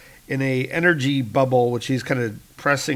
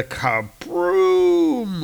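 explosive crack; 6 semitones higher than usual. Acted. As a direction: imitate a moon blowing apart. Voices the moon cracking.

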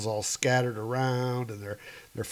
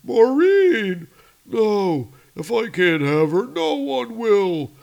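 villain's voice; 7 semitones higher, 6 dB louder than usual. Acted. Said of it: "He's like".

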